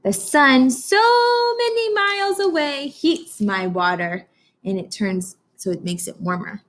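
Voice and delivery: put-on slow, expressive read-aloud for children; storybook reading. Teacher reading Atlantic to her class, slow storybook voice. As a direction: read it slowly like a picture book to kids.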